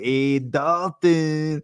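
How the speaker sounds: put-on slow, high Irish accent, drawn out; Conor McGregor impression. Podcaster mimicking Conor McGregor's Irish accent for his co-host. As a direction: stretch it out in a cartoonish Irish lilt. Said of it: "that's my Conor McGregor accent".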